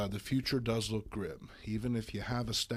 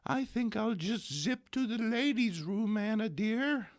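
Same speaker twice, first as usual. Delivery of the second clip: much higher, put-on old lady voice; sweet old lady; 11 semitones higher than usual. Aunt May excusing herself to Anna, high sweet old lady voice. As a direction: chirp it like a dainty old aunt. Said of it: "sweet old Aunt May".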